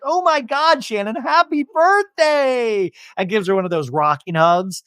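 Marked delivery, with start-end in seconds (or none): 0.0-2.9 much higher, slow over-the-top gush; excited gush. Retold or imitated. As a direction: squeal a birthday greeting. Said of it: "It's like".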